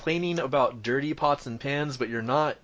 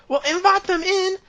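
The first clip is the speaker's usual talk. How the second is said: very high, louder woman's shriek; shouting wife; 5 dB louder than usual. Acted. Wife telling husband to let visitors in, shouting. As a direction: yell it in a piercingly high wife voice. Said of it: "the shouting kinda stops".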